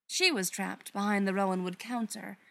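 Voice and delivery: usual speaking voice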